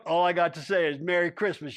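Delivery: ordinary delivery, nothing marked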